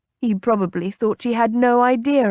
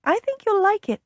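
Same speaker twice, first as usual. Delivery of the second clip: high, coaxing sales patter; enticing; 6 semitones higher than usual. A narrator's reading. Lady persuading the captain to try perfume, enticing. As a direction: coax him along in a higher voice. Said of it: "she said enticingly".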